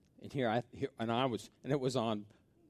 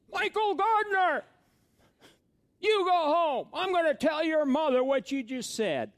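much higher, louder mock shout of an angry mother; mother shouting from the door; scolding mother; 13 dB louder than usual. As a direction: yell at the boy like his furious neighbor's mom. Neighbor mother scolding him.

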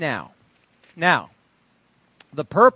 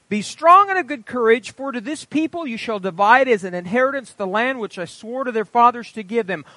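raised, ringing Bible reading; scripture reading; 6 semitones higher, 5 dB louder than usual. Acted. Preacher reading Joshua to the congregation, ringing proclamation. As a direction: proclaim the verses with weight.

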